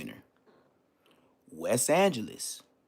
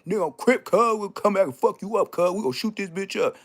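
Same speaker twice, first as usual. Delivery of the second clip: loud, high mock gangbanger threat; hammed-up street threat, higher and louder; gangster threat; 7 semitones higher, 4 dB louder than usual. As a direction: bark it like a Crip making threats. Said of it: "come back talking about".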